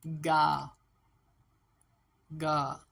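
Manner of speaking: गा held long as "gaa", firmly; syllable sound demo. Shown as a demonstration. Teacher answers what sound it makes, drawn-out गा. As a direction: sound out गा, stretching the vowel. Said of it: "तो ये क्या sound बनाएगा?".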